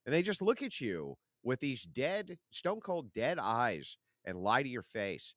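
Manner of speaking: normal talking voice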